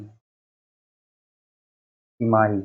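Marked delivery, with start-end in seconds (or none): none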